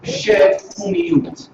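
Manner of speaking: "Chef Kumiut" said very slowly, each syllable apart; slow pronunciation. Shown as a demonstration. Teacher slowing down so the class can repeat. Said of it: "words list".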